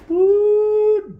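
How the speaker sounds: long, high, loud "woo"; real ringing whoop of delight; excited whoop. Real, not acted. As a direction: let out a sustained high whoop. Excited reaction to seeing something.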